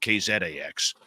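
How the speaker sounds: K Z A X with Canadian letter names; Canadian accent. Shown as a demonstration. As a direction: say K Z A X the Canadian way, zed not zee. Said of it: "in my Canadian accent".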